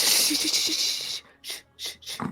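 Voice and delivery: whispered shushing; librarian shushing whisper. Acted. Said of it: "Just, like, shushing them".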